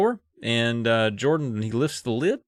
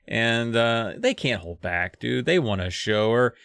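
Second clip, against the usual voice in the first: usual speaking voice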